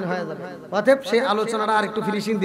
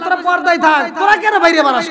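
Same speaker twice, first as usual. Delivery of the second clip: loud scolding rebuke; 7 semitones higher, 8 dB louder than usual. Acted. Voicing God's rebuke to women.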